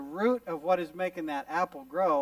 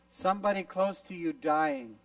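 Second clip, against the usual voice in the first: normal talking voice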